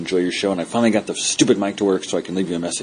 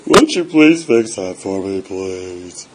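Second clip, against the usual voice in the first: mock tearful plea; 7 dB louder, slower than usual. Caller on a podcast voicemail begging the host to fix the link, mock tearful. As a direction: beg sweetly as if near tears, playfully. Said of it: "I'm trying not to get emotional about it".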